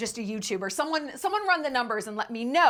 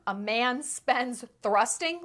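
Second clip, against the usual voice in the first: ordinary delivery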